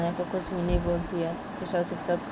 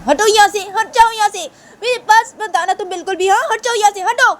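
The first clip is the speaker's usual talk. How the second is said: screaming high and loud, pushing her off; shrieking furious rage, much higher and louder; 13 dB louder than usual. Acted. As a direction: scream at her to get away. Shanaya shoves Tisha away, screaming.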